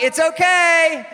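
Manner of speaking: slow, loud hammed-up "It's okay"; mock shout. Comic insisting he's fine, hammed-up slow shout.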